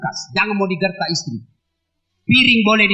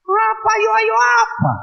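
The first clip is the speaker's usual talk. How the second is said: high sing-song call; 11 semitones higher than usual. Acted. Preacher leads crowd singing.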